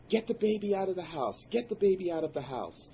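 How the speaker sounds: high, loud urgent command; urgent shout. Retold or imitated. Urgent order after the crash.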